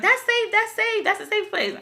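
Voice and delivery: everybody reassuring. Retold or imitated.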